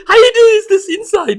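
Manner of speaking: high, loud incredulous cry; retold disbelief. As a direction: exclaim it high, half laughing in disbelief. Retells his baffled reaction, amused.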